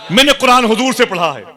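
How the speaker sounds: very high, ringing cry of devotion; fervent proclamation. Acted. Preacher repeats the prisoner's answer to the crowd, fervent proclamation. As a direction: cry it out high as the climax of a sermon. Preacher re-voices the climactic line.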